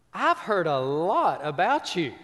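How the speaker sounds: teacher amused at the troublemaker; knowing teacher. Retold or imitated. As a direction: tease him like a teacher who knows his reputation. Teacher speaks to the boy.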